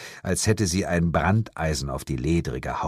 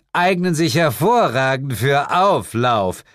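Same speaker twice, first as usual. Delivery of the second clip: high, louder, magnanimous grant; patronizing; 7 semitones higher, 5 dB louder than usual. A narrator's reading. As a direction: grant it grandly, as a favour. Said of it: "erklärte sie gönnerhaft". (gönnerhaft)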